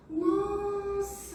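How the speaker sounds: high, drawn-out dawning wonder; acted amazement. Patient realising what a dream means, amazed.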